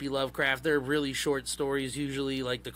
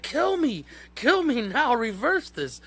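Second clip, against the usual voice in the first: much higher, mock-desperate begging; frantic pleading; 10 semitones higher, 4 dB louder than usual. Cult leader begs his followers to undo the ritual, high mock panic. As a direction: beg to be killed in a squeaky panic. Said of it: "He's like".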